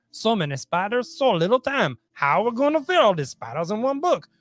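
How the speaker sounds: high, mock-amazed cry of delight; hammed-up wonder. Co-host marvelling at all the spiders, high and mock-amazed. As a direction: gasp it out high like a cartoon host.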